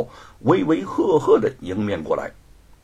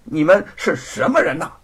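raised, stern questioning; officious narrated challenge; 6 semitones higher, 4 dB louder than usual. A narrator's reading. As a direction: demand it sternly, pitched up. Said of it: "厉声地问道".

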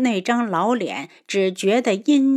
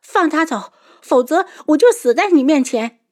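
high, raised-voice ultimatum; desperate threat; 8 semitones higher, 5 dB louder than usual. A narrator's reading. Daughter with dagger at her own neck, desperate. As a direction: demand it as a last resort.

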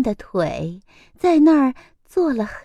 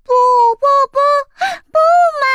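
put-on high, loud childish no-no-no; spoiled chick refusing to go home, wailing; tantrum whine; 6 dB louder, much faster than usual. A narrator's reading. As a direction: stamp your feet like a toddler refusing.

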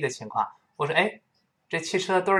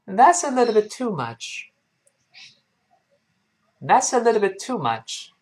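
higher-pitched, linked reading with a falling end; full model sentence; 7 semitones higher than usual. Shown as a demonstration. Teacher models the whole sentence for learners, high-pitched and linked. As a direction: start high, link the words, fall on "much". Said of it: "完整的来一遍".

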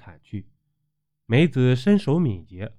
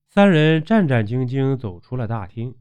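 usual speaking voice

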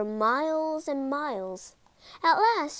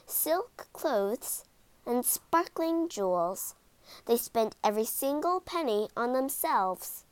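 plain reading voice

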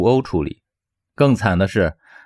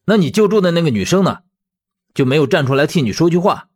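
heated, higher-pitched demand; angry, huffy; 7 semitones higher, faster than usual. Acted. Man asking why nobody defended his friend, huffy. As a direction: fire off indignant questions. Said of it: "气冲冲地说道".

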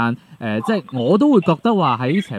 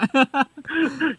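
high giggle after teasing; high laugh; 13 semitones higher, 3 dB quieter than usual. Real, not acted.

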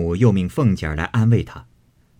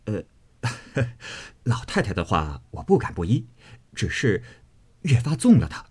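laughing reply. A narrator's reading. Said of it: "想必便笑道".

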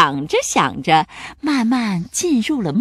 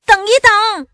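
shouting; 12 semitones higher, 8 dB louder than usual. A narrator's reading. Pirate running up to halt the execution, shouting. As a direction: cry out to stop them. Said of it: "他大叫".

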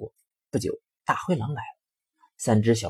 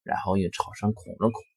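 ordinary delivery, nothing marked

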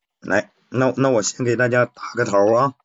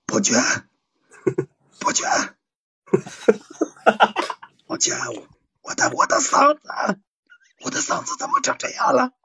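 much higher, cracking laughing consort voice; 安陵容 impression. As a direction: wail as the ruined-voice concubine, breaking into laughs. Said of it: "我先抛砖引玉一下". (高声模仿安陵容，忍不住笑)

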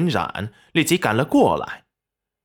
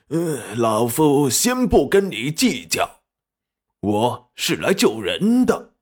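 put-on slow, drawn-out old man's voice; slow, self-important elder waving off a slight; grudging old man. A narrator's reading. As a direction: draw it out like a huffy elder. Old man grudgingly dismisses the young man.

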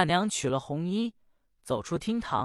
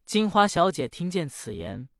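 usual speaking voice

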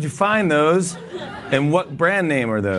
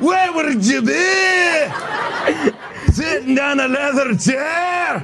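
very high, stretched-out Shrek-like Scottish; Scottish accent; 13 semitones higher, much slower than usual. Acted. Interviewer clowns for his guest, over-the-top Scottish accent. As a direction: push the Scottish brogue high and long, like Shrek. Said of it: "You sound like Shrek".

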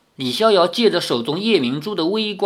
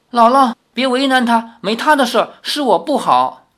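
higher, louder urgent pleading; anxious plea; 6 semitones higher, 5 dB louder than usual. A narrator's reading. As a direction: rush to defend him. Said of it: "赵灵儿急着说". (焦急地求情)

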